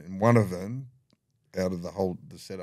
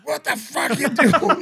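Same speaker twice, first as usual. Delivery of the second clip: much higher, loud, slow shout; shocked yell; 13 dB louder, slower than usual. Retold or imitated. Mates bursting into the cell, shouting in shock. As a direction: bellow it in disbelief.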